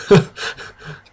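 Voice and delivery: stuttering, half-laughing fake cry; mock sobbing stammer. Reading the heroine's tearful confession, hammed-up. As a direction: stammer through fake tears. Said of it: "she started crying".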